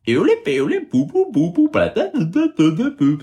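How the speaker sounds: high, playful nonsense babble; sing-song nonsense, very high; babbling voice. Acted. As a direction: babble gibberish like a silly creature.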